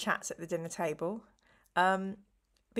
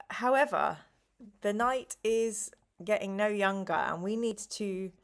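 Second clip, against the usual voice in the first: ordinary talk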